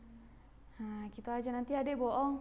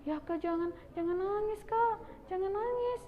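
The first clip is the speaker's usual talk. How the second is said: pleading; 6 semitones higher than usual. Acted. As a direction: beg a crying sibling to stop. Begging crying sibling to stop.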